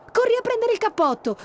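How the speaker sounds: put-on little girl shouting, high and loud; shouted order. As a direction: yell to send him running. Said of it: "gridò Giulia".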